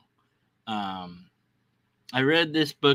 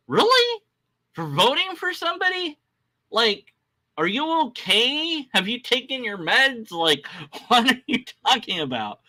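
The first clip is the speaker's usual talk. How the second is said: mock incredulous; 11 semitones higher than usual. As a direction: question their sanity in exaggerated, high-pitched disbelief. Incredulous acted reply to news alarm.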